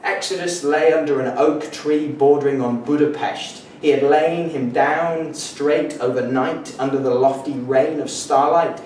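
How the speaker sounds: declaimed, measured poetry recital; poetry reading. Acted. Poet reads a collage of modernist fragments to an audience, declaimed. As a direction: read each fragment out deliberately with projection.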